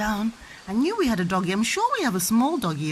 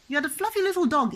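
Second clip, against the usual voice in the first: normal talking voice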